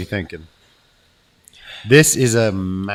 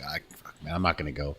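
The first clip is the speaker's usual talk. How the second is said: much softer, lower scared mumble; mock scared mutter; 5 semitones lower, 10 dB quieter, faster than usual. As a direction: mutter it like you're frozen at the edge. Scared to jump.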